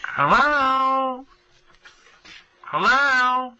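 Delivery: high, laughing calling hello; mother calling into machine. Retold or imitated. Mother calling into the answering machine, high and laughing. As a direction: call out like mom, half laughing. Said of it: "It's like".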